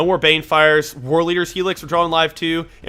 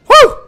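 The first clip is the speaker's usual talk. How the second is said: relieved whoop; 12 dB louder than usual. Real, not acted. Narrowly survives opponent's turn.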